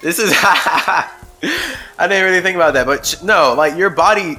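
laughing through tears; about to cry. Real, not acted. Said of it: "I'm about to cry right now".

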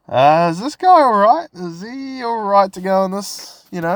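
high, put-on voice of an uneasy ride worker; worried ride operator. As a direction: voice the operator asking with concern.

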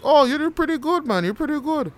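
loud falsetto imitation of an impressed instructor; mock-amazed, voice way up; high-pitched praise. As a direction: gush in a squeaky put-on high voice.

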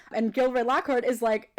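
ordinary talk, nothing marked

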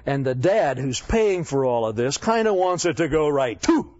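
normal talking voice

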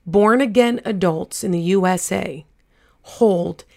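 slow, emphatic statistic, phrase by phrase; measured delivery with weighty pauses; deliberately slow. Real, not acted. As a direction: spell it out slowly so it sinks in. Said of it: "I'm gonna say this slower".

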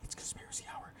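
whispered, like sharing a secret; mock conspiracy whisper. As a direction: hush it like a conspiracy theorist. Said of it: "put on your tinfoil hats".

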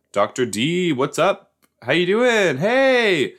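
gushing, climbing high-pitched hello; rising squeal of welcome; excited greeting. Pretend. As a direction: greet her like a favorite teacher. Calls out to show character.

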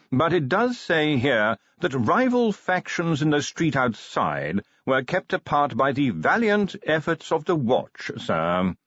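formal butler. Acted. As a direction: read the news out like a proper manservant. Voices butler in story.

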